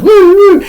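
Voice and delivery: high, loud woo woo like a siren; mock police siren; police siren imitation. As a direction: wail it like a cop car.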